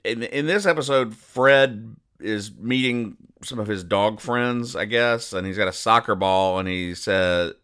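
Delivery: ordinary talk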